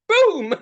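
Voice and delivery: loud, drawn-out boom; imitated explosion. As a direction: let it burst like a motor blowing. Imitates the motor blowing up.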